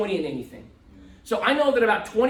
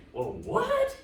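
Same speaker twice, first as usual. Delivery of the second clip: surprised retort. Retold or imitated. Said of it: "And I was like".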